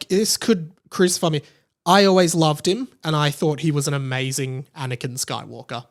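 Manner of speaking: ordinary talk, nothing marked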